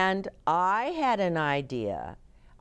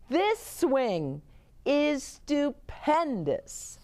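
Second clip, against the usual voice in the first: high, drawn-out delight; thrilled boy; 8 semitones higher, slower than usual. A narrator's reading. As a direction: proclaim it high, savouring the last word. Jose praises the swing.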